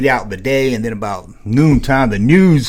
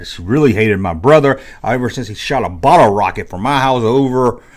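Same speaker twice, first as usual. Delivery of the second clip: ordinary talk, nothing marked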